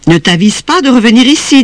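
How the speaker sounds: high-pitched threatening mother; menacing. Acted. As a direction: warn the child off sternly. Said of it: "d'un ton menaçant".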